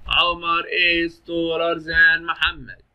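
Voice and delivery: very slow, stretched teacher's example; slow dictation-style delivery; drawn-out model sentence. Shown as a demonstration. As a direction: stretch every word out for the class.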